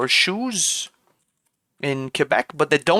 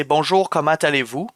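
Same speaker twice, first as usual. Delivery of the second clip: everyday Québécois pronunciation; Quebec French accent. Shown as a demonstration. Streamer shows chat Quebec's greeting, Québécois accent. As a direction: say it as they do in Quebec. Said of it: "in Quebec, we'd say".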